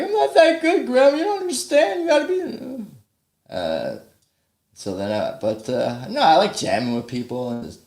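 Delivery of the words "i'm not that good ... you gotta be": high, strained complaint to grandma; whiny protest. Retold or imitated. A grandchild protesting to grandma, squeaky whine. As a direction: pitch way up and whine like a kid. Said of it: "And I'd be like".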